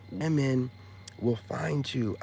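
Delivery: normal talking voice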